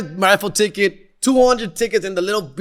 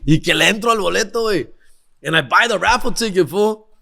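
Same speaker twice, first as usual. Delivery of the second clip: ordinary talk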